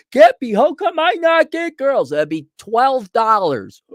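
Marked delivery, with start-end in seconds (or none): 0.1-2.1 high, loud whining mock voice; mock whiny client. Mocking a client who begs Cappy, high and loud. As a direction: wail it like a hopeless loser.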